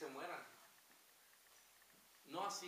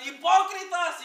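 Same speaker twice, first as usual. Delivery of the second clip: slow, much higher, far louder shouted accusation; Jesucristo denouncing; 12 semitones higher, 21 dB louder, slower than usual. Retold or imitated. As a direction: fling the word like a condemnation. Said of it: "Y el Señor Jesucristo está".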